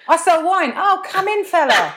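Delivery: hammed-up chummy host waving a stranger in; mock casual welcome. Mock scene of wine seller welcomed.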